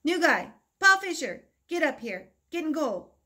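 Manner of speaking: loud, high call across the field; coach yelling. Retold or imitated. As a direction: shout it down the pitch to the new kid. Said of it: "The coach yelled down".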